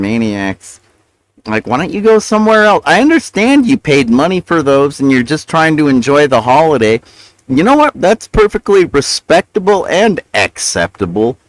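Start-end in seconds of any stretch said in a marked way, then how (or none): 1.5-5.1 exasperated. Real, not acted. Exasperated at neighbors. 7.5-11.4 sarcastic. Real, not acted. Mock magnanimous concession.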